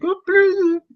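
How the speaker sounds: put-on high-pitched botched robot accent; squeaky, abandoned stab at an accent; failed Chappie accent. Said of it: "can't even do the accent".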